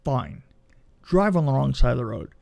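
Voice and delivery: ordinary talk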